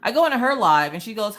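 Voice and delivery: usual speaking voice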